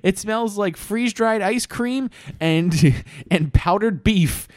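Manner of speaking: high-pitched, slow fed-up whine; squeaky complaining boy voice; whiny drummer boy. Acted. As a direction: whine it out like a miserable kid soldier. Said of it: "He was just like".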